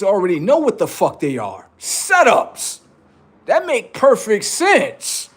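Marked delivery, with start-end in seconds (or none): 1.8-5.3 mock knowing. Comic punchline, pitched up.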